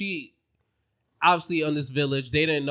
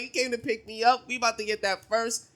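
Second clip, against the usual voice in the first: high, thrilled imitation; mock gushing; 9 semitones higher than usual. Villager thinking he came to pick her up, mock thrilled. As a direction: act out showy delight in a raised pitch. Acting out her delight at pickup.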